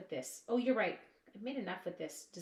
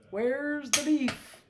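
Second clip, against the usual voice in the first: loud, high, drawn-out catchphrase; mock outraged commercial line, stretched long; old commercial catchphrase; 7 semitones higher, 10 dB louder, much slower than usual. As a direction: call it out slowly like the old advert. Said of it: "Do you remember that commercial".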